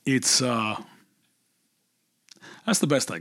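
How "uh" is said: ordinary delivery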